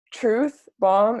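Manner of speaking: usual speaking voice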